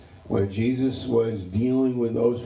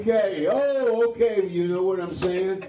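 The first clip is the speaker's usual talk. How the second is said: playful delight; 10 semitones higher than usual. Real, not acted. Playful reply to listener.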